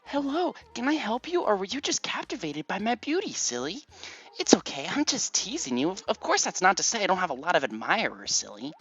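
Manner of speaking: put-on dumb, girly, high-pitched voice; Rosie. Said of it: "as dumb and girly as a voice as Rosie".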